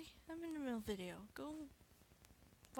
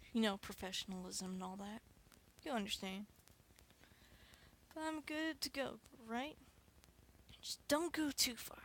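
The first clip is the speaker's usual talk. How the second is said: normal talking voice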